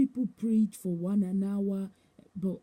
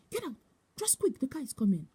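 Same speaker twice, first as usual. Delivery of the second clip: imitated urgent warning; 5 semitones higher than usual. Bishop to woman as car comes, urgent imitated warning. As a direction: mimic ordering someone out of danger.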